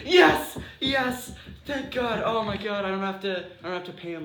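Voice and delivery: shouted mock triumph, fading at the end; jubilant shout. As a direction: cheer like you just dodged a huge bill. IRS guy thrilled at the pee.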